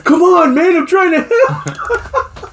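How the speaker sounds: high, drawn-out comic plea; mock whiny begging. Rejected helper begging the cop, mock whining. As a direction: whine it as if close to laughing.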